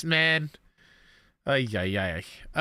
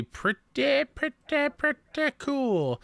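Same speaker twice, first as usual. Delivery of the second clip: playful high drawn-out pretty; 12 semitones higher, much slower than usual. Real, not acted.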